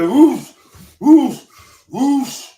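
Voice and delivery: loud, high whooshing exertion bursts; workout grunts. Retold or imitated. Storyteller imitating the trainer carrying on lifting, loud grunts. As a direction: punch out each noise like a heavy rep. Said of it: "He was making these noises like".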